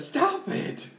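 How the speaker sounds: high, whiny stop it. Real, not acted. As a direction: whine it out, high. Telling friend to stop.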